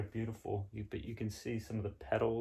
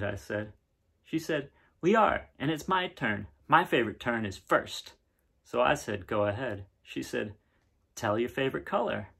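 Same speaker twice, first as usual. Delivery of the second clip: brighter, livelier read-aloud voice; story narration; 4 semitones higher, 3 dB louder than usual. A narrator's reading. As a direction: read it to the kids with wonder. Reads picture book aloud.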